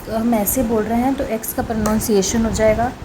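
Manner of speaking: ordinary talk, nothing marked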